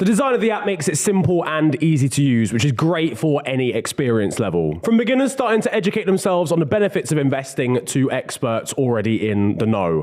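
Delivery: ad read. Acted. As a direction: read it like a sponsor spot. Reads sponsor ad.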